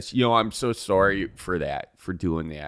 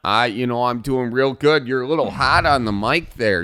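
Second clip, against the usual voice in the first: Northwoods radio host; 3 semitones higher than usual. Acted. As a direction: welcome listeners like a backwoods radio show host. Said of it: "I'm the host of Northwoods Radio".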